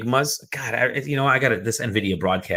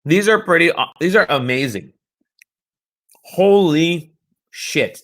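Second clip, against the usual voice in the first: excited; 7 semitones higher, 3 dB louder than usual. Real, not acted. Tasting pretzels, delighted.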